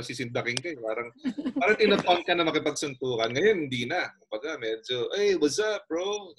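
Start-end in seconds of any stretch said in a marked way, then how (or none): 5.1-6.3 casual bro greeting. Retold or imitated. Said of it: "Ganun na ang tawag sa'yo".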